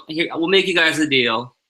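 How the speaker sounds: ordinary talk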